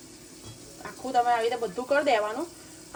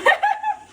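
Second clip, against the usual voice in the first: very high, loud giggle; short high-pitched laughing burst; 7 dB louder than usual. Real, not acted. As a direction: squeal with laughter. Laughing about viewers' fear.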